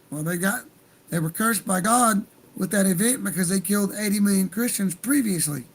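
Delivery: ordinary talk, nothing marked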